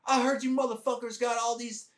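mock the old sergeant major confronting the platoon. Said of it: "he's like".

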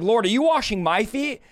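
ordinary talk

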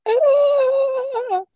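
very high, loud strangled cry; imitated strangled yell. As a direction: mimic a choked, panicked scream. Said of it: "how you project that sound".

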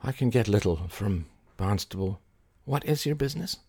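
put-on soft, tired old man's voice; hushed, worn-out character voice; weary. A narrator's reading. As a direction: ask as if just woken. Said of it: "he said wearily".

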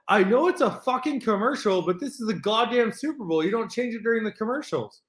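high-pitched, exasperated re-enacted rant; retold angry yelling. Scolding the host for switching during Super Bowl commercials, angry. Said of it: "I literally said".

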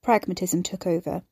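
ordinary delivery, nothing marked

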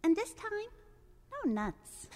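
high, sweet duckling request; duckling asking. Acted. Duckling asks god for cookie, high and sweet. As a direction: ask the sky politely like a tiny duckling. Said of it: "looks up ... and says".

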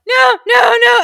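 loud, high desperate no; panicked shout. Retold or imitated. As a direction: scream it at the page. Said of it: "I'm so like".

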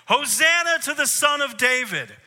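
high, loud joyful cry; loud high hosanna cry; shouting praise. Retold or imitated. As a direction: shout it out like excited kids. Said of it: "came in shouting at the top of their lungs".

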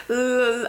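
"L-" as a drawn-out L sound; held L sound. Shown as a demonstration. Showing the sound she keeps wanting to say, held L. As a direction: hold the L in "L-" without a vowel. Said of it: "I wanna say an L sound".

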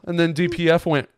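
ordinary talk

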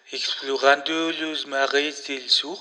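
slow, hammed-up French accent. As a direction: drawl it like a TV French explorer. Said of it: "necesitamos con acento francés".

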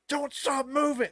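high, loud imitation of a yelling worker; shrill-pitched mock order, yelled out. Ride worker to guests stopping for pictures, yelling. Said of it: "Like, yelling at us".

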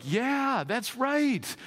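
high, eager crowd cheering along; a touch of a self-righteous crowd; excited agreement. Retold or imitated. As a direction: egg the prophet on gleefully. Israelites cheering against other nations.

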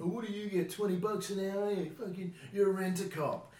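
high, loud mocking snob voice; sneering mimicry. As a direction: sneer down your nose like a smug teen. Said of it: "look down their nose at you".

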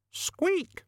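very high, thin rodent squeak; piercingly high squeak; rat squeak. A narrator's reading. Death of Rats squeaks.

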